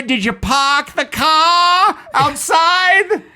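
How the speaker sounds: put-on high, slow, drawn-out Boston accent. Podcaster mocks CVS customers with a slow, high Boston accent. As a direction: ham up a Boston local asking about the car. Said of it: "Everyone's got a Boston accent".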